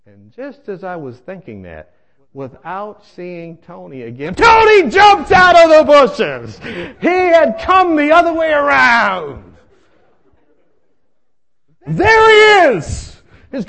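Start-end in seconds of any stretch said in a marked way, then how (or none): 4.3-13.1 high, drawn-out comic holler; alarmed shout. Retold or imitated. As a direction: scream the ambush in mock horror. Climactic reappearance in comic story.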